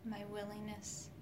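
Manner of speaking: soft whispered meditation voice; right in your ear. Acted. Leads a guided healing meditation.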